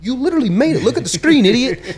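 loud, very high mock yelling; exasperated insistence. Friends pointing the angler to the scoreboard, exasperated mock yell.

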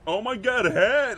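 lightly hammed-up feminine voice; acting like a woman. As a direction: pitch it up like a gushing girl. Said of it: "how do I act like a woman".

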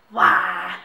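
loud, low, drawn-out WHY; mock exclaimed why. Vlogger acting out a typed question, mock exclaimed why. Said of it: "And then she's gone".

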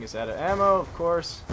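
ordinary talk, nothing marked

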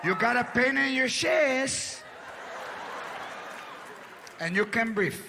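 slow, drawn-out echo of his complaint; mocking wife. Wife to her sick husband when he needs her, mock concern. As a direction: repeat his words with acted scorn. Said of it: "now she's like".

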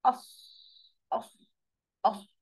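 "أص" short, with a full hissed ص; heavy sad. Shown as a demonstration. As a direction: isolate "أص", mouth rounded and full. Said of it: "بس أقولها زي أنا".

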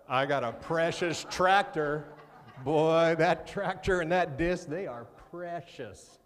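loud, laughing mock farmer boasting; booming, chuckling parody of a burly man; mock guy bragging. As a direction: ham up a big farm guy calling his tractor precious. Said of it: "You ever hear a guy go out and say".

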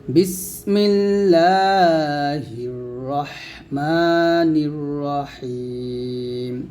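রহমানির with a clear breathy h; slow recitation with a clean h in রহমানির; correct ha. Shown as a demonstration. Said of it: "হার উচ্চারণটা এখন সঠিক করে নিয়ে আসতে হবে".

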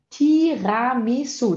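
slow, syllable-by-syllable "Tiramisu"; sílaba por sílaba. Shown as a demonstration. Teacher sounds out the word. ("Tiramisu" devagar, sílaba por sílaba)

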